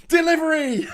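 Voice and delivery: high, laughing mock cheer; gleefully sarcastic punchline; laughing sarcasm. Sarcastic punchline about Brexit benefit.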